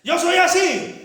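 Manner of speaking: loud, high defiant shout; macho husband shout. Acted. Husband shouting down his wife, loud defiant. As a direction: bark it at her, swaggering.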